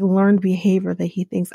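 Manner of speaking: ordinary talk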